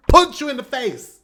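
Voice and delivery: high, loud burst breaking into a laugh; sudden punchy burst. Retold or imitated. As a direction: hit it hard like a beat drop. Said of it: "it's gonna be calm".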